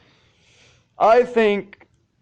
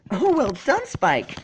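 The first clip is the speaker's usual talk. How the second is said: high, bright pony voice; Twilight's praise; 10 semitones higher than usual. A narrator's reading. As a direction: cheer him on in a high voice.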